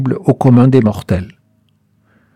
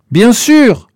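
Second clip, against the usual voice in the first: high-pitched call, only slightly raised; mild narrated yell; 8 semitones higher than usual. A narrator's reading. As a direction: call back from a distance, restrained. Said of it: "hurla Albert à son tour". (cri modéré, nettement plus aigu)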